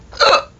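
sharp hiccup gasp; loud hiccup catch. Real, not acted. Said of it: "I got the hiccups".

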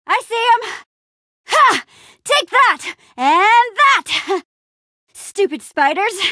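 high, effortful battle cries; combat shouts. Acted. Adventurer fighting off spiders, combat shouts. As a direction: strike hard on each phrase, pitched high.

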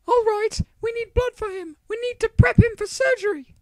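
high falsetto woman's voice, loud and hurried; put-on female doctor. Doctor to paramedics as gurney rushes in, hurried loud falsetto. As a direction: pitch it way up as an urgent female doctor. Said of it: "I have very few female voices".

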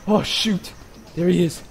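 ordinary talk, nothing marked

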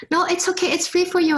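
put-on high Chinese-accented woman urging her to come. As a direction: imitate the Chinese lady on the phone, Chinese accent. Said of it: "I butchered that Chinese accent".

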